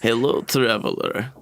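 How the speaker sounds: low, Dracula-like Eastern European goblin; Eastern European accent. Acted. Goblin greeting a passing traveler, Eastern European accent. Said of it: "it's very, very Eastern European".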